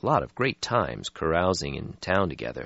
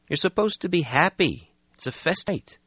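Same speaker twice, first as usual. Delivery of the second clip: brisk, a bit higher; young vampire voice; 13 semitones higher than usual. Acted. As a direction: brush off the gloom. Lucky voiced in story.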